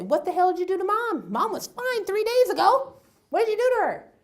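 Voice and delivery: high, loud, rapid outburst; angry accusation. Acted. Acted angry phone call to brother.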